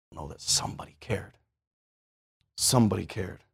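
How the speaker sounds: gentle, sincere appeal; tender. Acted. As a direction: drop down to a tender hush. Soft after shout.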